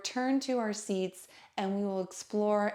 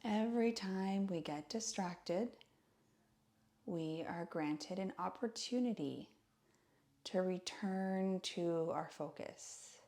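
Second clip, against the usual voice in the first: guided meditation; 2 semitones lower, 7 dB quieter than usual. Acted. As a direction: guide listeners gently into stillness, hushed and unhurried. Leads a seated breathing meditation.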